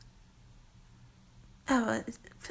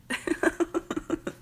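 high, rippling giggle; amused giggle; 4 semitones higher than usual. Acted. Laughing at their own made-up word, high giggle. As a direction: break into a light, rising laugh.

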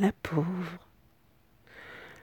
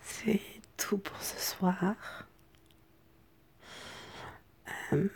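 hoarse, cracking whisper; 3 dB quieter than usual. Real, not acted. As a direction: whisper through a worn, broken voice. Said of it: "ma voix cassée".

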